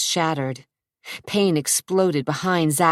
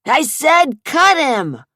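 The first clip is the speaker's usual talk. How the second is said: high, loud, slow yelled order; yelling; 10 semitones higher, 7 dB louder, slower than usual. Acted. Joey yells at Rudy to cut him, loud yell. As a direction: bark the order. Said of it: "Joey yelled".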